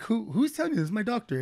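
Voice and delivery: light imitation of a Russian accent; a hint of a Russian accent, high. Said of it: "she had a Russian accent".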